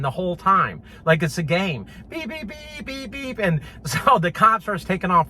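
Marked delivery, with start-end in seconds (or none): 2.1-3.4 high, soft beep beep like a moped horn; high soft horn noises made with the voice; imitated horn beeps. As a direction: mimic a little scooter horn.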